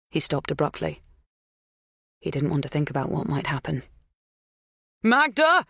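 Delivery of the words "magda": high, loud call across a distance; raised, high-pitched yell; yelled name. A narrator's reading. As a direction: yell it out urgently. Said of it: "he yelled instead".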